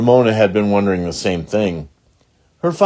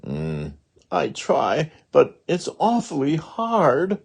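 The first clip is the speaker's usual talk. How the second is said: reluctant whine; 7 semitones higher, slower than usual. Acted. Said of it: "Ramona made a face".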